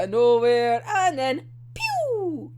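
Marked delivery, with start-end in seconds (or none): 1.7-2.5 high, drawn-out cartoon "pew"; imitated gunshot. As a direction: stretch out a toy-gun zap.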